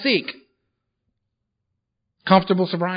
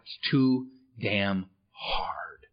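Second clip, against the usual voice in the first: barely voiced, slow and tearful; crying whisper; 8 semitones lower, 9 dB quieter, much slower than usual. Real, not acted. To the meeting, reliving the night he gave up: tearful whisper. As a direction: let the words break into a sob as you whisper.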